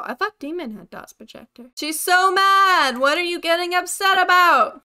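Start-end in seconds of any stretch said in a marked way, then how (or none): 1.8-4.8 exasperated shout. Real, not acted. Player confronting an angry ghost, exasperated shouting. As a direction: shout back in disbelief.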